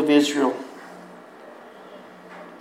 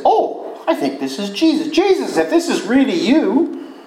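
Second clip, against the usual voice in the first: high, excited voiced Peter; hopeful shout to Jesus; eager Peter; 8 semitones higher than usual. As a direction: let Peter's hope leap up. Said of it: "Peter recognizes them ... and says".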